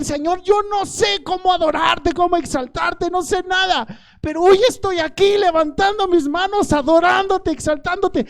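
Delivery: loud, high, impassioned sermon cry; full-force preaching. Acted. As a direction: cry out to God before the congregation.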